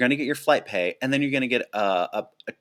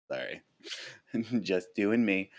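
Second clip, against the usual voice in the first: normal talking voice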